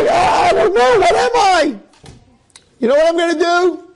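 high, loud crying shout; anguished cry. Acted. Yankel cries out after Satan's insult, anguished wail. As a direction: wail it out in distress.

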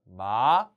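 마 starting low and sliding up; rising tone. Shown as a demonstration. Teacher coaching a hard tone, 마 gliding upward. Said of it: "올리면 돼요. 낮게 시작하세요".

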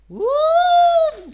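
high, drawn-out scream. Real, not acted. Streamer cutting loose mid-game, screaming. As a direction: let out a long excited scream. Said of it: "I'm sorry for that screaming".

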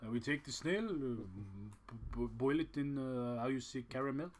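mocking a French speaker in a clumsy French accent; bad French accent. Podcast host mocks the French in a bad French accent. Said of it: "Worst French accent ever".

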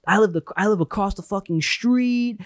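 high-pitched enthusiastic sales patter; happy, energetic. Retold or imitated. New store worker chatting to customers, high-pitched eager excitement. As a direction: gush like a thrilled new employee. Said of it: "I was just as energetic".

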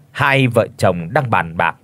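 ordinary delivery

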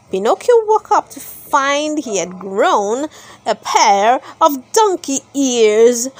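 ordinary delivery, nothing marked

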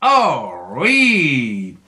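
long swooping puppet bear cheer; drawn-out cartoon bear delight; Syllabe the bear. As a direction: cheer like an eager bear puppet, stretching both words. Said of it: "je suis l'ours Syllabe".

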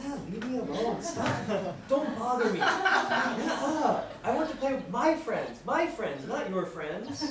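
put-on high, loud whiny kid brushing off a sibling, laughing; laughing whine of a kid who wants to be left alone; annoyed older sibling. Teacher plays a big sister shooing off her little brother, loud and whiny. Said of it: "Sometimes you're like".

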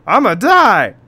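loud, high desperate yell; over-the-top doomed outburst; mock terror. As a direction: shout it in hammed-up panic. Trapped in box, oxygen running out.

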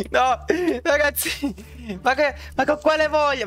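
high exasperated shouts; being chased. Real, not acted. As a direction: yell in disbelief. Overwhelmed by attackers.

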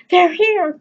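high, loud shout of joy; excited cry. Acted. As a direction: call it out as the guests arrive. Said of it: "she cried".

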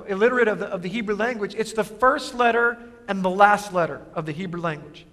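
ordinary talk, nothing marked

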